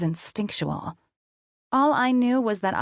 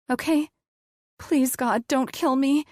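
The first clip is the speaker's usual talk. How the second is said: high, frightened pleading; 8 semitones higher than usual. A narrator's reading. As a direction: beg him not to hurt you.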